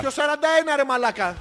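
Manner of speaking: loud, high protest; indignant protest. Real, not acted. Host loudly disputing his age, indignant and high. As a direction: shout back offended at the age.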